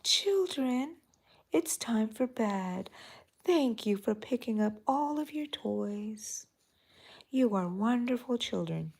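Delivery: a hint of a sweet singsong mom tucking kids in; nice beautiful voice. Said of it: "this nice, beautiful voice saying".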